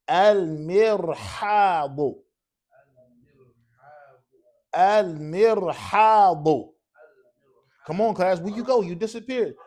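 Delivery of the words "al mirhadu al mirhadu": loud, heavily stretched syllables; very slow dictation. Shown as a demonstration. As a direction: call it out very slowly for the class to write. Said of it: "it's dictation in Arabic".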